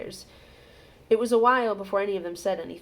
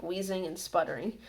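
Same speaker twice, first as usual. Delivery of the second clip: usual speaking voice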